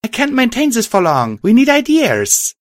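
urgent high-pitched call for help; urgent shout. A narrator's reading. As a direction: shout it high and strained for time. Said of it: "I shouted as I explained".

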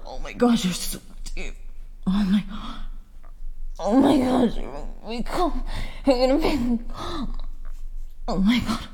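breathless moans and gasping words; aroused moaning. Real, not acted. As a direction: moan each word, close to climax.

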